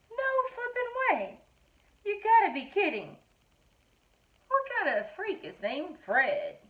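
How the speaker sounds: shocked disbelief. Acted. Kid reacts to the name Fred.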